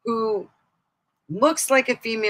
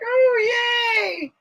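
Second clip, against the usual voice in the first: mock surprise. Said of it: "you're like".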